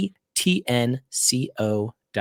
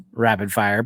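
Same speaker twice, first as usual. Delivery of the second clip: normal talking voice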